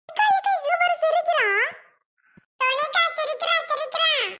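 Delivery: put-on very high, excited little-kid voice; excited child. Child telling big sister the baby is smiling, excited. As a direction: squeal the good news up high.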